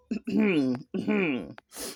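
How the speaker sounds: loud, repeated throat clearing. Real, not acted. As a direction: clear your throat hard, several times. Reader clears throat mid-story.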